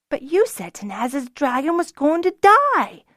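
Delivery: put-on boy objecting angrily to Zinna; angry protest. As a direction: protest like a hurt little boy. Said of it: "Denon protested angrily".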